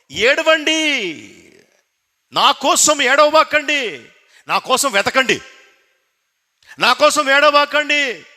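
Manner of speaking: preacher urging the people not to cry for worldly things, full force; fiery preaching. Acted. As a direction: pound each line home to the congregation.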